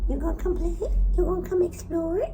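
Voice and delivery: high, loud coaxing questions; excited pet talk. Real, not acted. Owner inviting animals to play, excited high pet talk.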